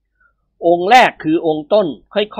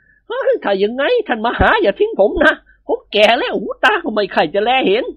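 put-on whispering old monk; 8 semitones higher than usual. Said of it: "กระซิบบอก".

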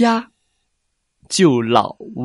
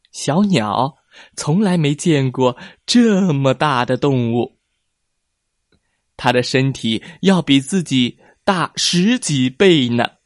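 plain reading voice

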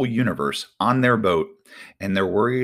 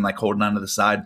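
ordinary talk

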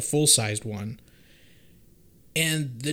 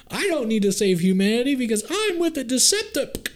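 mock smug villain voice; 10 semitones higher, 3 dB louder than usual. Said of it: "he's like".

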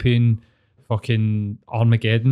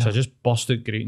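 normal talking voice

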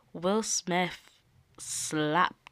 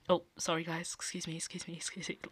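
apologetic murmur; 8 dB quieter, faster than usual. Retold or imitated. Will going back to his seat.

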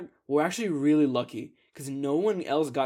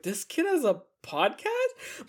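slow mock disbelief; 10 semitones higher, much slower than usual. Teacher disbelief.

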